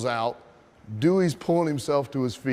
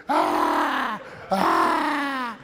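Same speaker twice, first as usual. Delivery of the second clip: long, high, loud "aah" screams; drawn-out mock howl of pain; imitated pain scream; 7 semitones higher, 3 dB louder than usual. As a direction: scream like a wrestler in agony.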